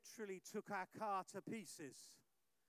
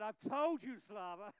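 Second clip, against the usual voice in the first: firmer warning; 10 dB louder than usual. Retold or imitated. Repeats warning more firmly.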